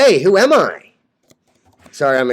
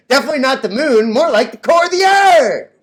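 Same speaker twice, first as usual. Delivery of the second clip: mocking shout; 6 semitones higher than usual. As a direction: shout the punchline as a taunt. Mocking rival's followers.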